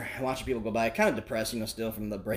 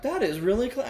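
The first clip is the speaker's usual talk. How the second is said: marvelling; 11 semitones higher, 4 dB louder than usual. Retold or imitated. Still marvelling at the trick.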